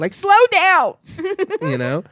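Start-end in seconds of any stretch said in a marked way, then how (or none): 0.1-0.9 high-pitched call across the yard. Retold or imitated. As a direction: holler it like a finger-wagging mother. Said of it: "wagging your finger at kids".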